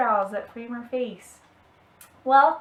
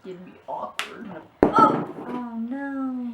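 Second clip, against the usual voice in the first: ordinary talk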